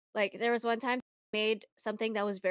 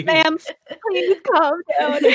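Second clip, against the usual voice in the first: laughing plea; 8 semitones higher, 13 dB louder, slower than usual. Retold or imitated. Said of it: "You're like".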